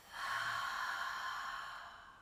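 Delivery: long, voiceless breath out through the mouth; long sighing exhale. Shown as a demonstration. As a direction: demonstrate a slow, audible out-breath for the class. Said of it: "out through the mouth".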